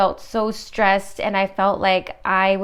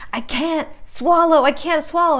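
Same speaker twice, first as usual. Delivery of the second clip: high-pitched mock panic; panicking patient; 6 semitones higher, 3 dB louder than usual. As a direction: imitate a patient freaking out. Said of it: "he was freaking out".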